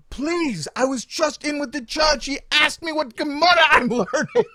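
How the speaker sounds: high, loud, laughing plea; beg, half laughing at yourself; pleading with the clerk in a high, loud, laughing voice; desperate pleading. Retold or imitated. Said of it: "so I'm saying to him".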